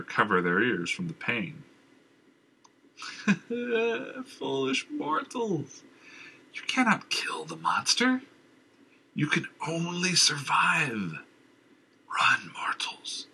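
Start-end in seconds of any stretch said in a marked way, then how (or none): 3.0-4.2 high mad cackle; unnerving cackle. Acted. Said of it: "an unnerving cackle". 4.2-11.2 high slow mad taunt; taunting madman. Acted. Madman taunts after cackle. 12.1-13.3 slow eerie whisper. Acted. Madman whispers warning.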